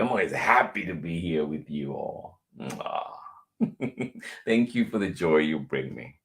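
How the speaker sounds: ordinary talk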